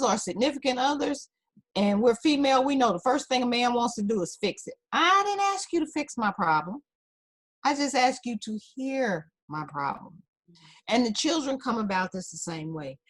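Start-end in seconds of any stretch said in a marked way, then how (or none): 4.9-10.2 higher-pitched, lightly acted complaint; playful imitation of an exasperated woman; mock protest. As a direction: object like a partner who wants to be heard. Acts out woman objecting to fixing.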